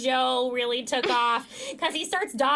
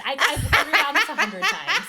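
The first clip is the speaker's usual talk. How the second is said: laughing through the words, higher and louder; 5 semitones higher, 7 dB louder than usual. Real, not acted. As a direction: crack up while telling it.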